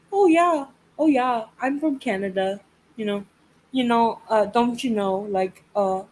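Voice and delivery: chatty mock Canadian accent; hammed-up Canadian accent. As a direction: imitate a Canadian nurse's accent making small talk. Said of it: "your fucking accent drove me fucking nuts".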